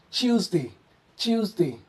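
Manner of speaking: "Tuesday" as chooz-day, British way. Shown as a demonstration. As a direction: soften the t of "Tuesday" to ch. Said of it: "we pronounce this in British accent as".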